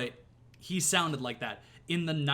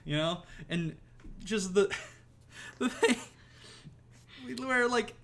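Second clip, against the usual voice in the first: usual speaking voice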